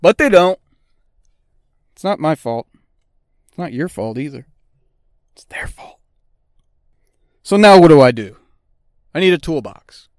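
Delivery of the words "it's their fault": whisper. Acted. Conspiratorial aside blaming the shops.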